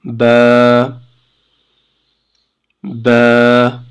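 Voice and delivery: ba drawn out at length; long vowel ba. Shown as a demonstration. Teacher repeating the long vowel for students, stretched. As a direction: hold the a in ba. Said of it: "on va prolonger".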